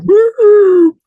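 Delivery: high loud siren whoop; sudden piercing siren chirp; imitated police siren.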